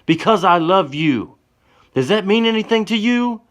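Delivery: very high, loud hurt scream; piercing, anguished outcry. Acted. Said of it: "slaps Johnny in the face and screams".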